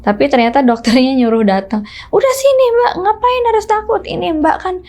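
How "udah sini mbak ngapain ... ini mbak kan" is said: much higher, coaxing doctor voice; imitating the doctor. Doctor coaxing the frightened patient to come in, imitated much higher. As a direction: play the doctor gently urging a scared patient.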